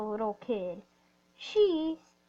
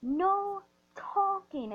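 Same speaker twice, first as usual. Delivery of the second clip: slow, high, clipped mock order from the bus driver; stern bus driver; 7 semitones higher, much slower than usual. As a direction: drag out "No talking." like a strict driver scolding a kid. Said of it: "she's like".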